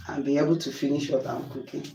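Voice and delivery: ordinary delivery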